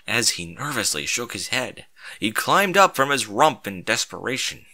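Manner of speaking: usual speaking voice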